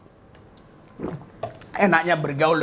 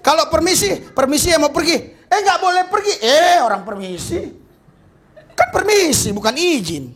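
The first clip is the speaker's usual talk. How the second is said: high, loud preaching; sermon in full force; 10 semitones higher, 4 dB louder than usual. Acted. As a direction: hammer the point home. Preaching dialogue.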